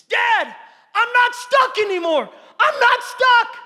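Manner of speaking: very high, loud excited cry; joyful child shout. Retold or imitated. Imitating his son shouting happily that he is free. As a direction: shout it with delight.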